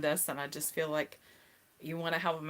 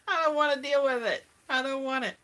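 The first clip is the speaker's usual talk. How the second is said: laughing through the words, higher and louder; 9 semitones higher, 7 dB louder than usual. Real, not acted. Laughing to viewers about hating the cold, giggly. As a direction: giggle while protesting the cold.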